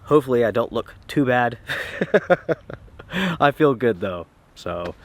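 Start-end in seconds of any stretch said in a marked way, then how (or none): none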